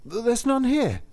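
high, sudden, stammered brush-off; abrupt denial. Acted. Lustig to Saint Peter, abrupt high blurt. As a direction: blurt it out quickly, caught out. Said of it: "said abruptly".

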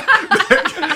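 loud, high burst of real laughter; real laugh breaking out. Real, not acted. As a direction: crack up loudly. Laughing at his own imitation.

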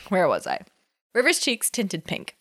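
usual speaking voice

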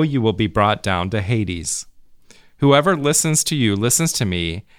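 normal talking voice

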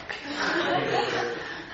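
a soft, breathy burst of laughter. Real, not acted. Teacher amused by the gleaners' huge haul, breathy laugh. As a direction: laugh under the breath at the idea.

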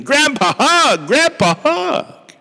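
put-on shrieking toddler. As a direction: squeal it like an excited two-year-old. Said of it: "shrieked the delighted two-year-old".

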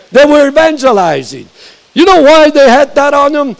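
normal talking voice

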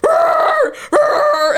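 loud, high mock animal roar; barking roar. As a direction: roar at him like a wild dog to scare him off. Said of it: "I just start barking".

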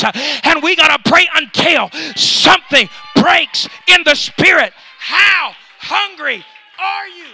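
loud, insistent pulpit challenge; full-force preaching. Acted. Preacher asking how hungry the congregation is, full-force preaching shout. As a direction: hammer each question at the crowd.

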